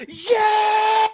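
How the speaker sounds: high, long shouted yell; mimicked entrance scream. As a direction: let out a hyped wrestler's scream.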